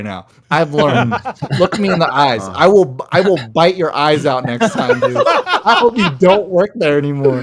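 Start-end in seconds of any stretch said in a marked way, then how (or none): 1.6-7.4 hammed-up threat, louder and higher, laughing through it; joking fury at the waiter who upsold him; mock rage. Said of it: "The rage is coming back".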